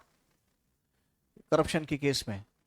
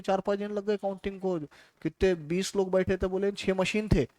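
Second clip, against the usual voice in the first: ordinary talk, nothing marked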